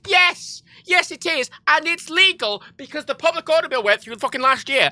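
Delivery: high, forceful real indignation; exasperated retort. Real, not acted. Answering complainers about civil liberties, angry and exasperated.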